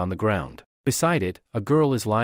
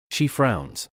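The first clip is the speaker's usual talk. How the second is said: ordinary delivery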